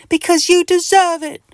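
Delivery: high, loud little creature voice; little itch creature speaking from the sink; tiny voice. Acted. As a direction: answer in a tiny echoing mite voice. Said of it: "replies a tiny voice".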